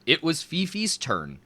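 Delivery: ordinary delivery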